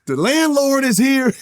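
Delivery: high, drawn-out call; retold gleeful yell. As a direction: imitate yourself hollering an announcement across a gym. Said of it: "I'll just yell".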